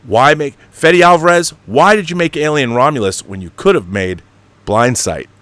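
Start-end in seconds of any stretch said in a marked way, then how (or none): none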